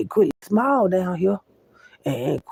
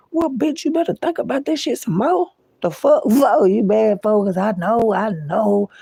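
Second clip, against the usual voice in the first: high-pitched, incredulous clapback; sassy comeback; 6 semitones higher, 3 dB louder than usual. Acted. Speaker confronts someone mad at their pride, sassy and taunting. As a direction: fire back in a high, taunting voice.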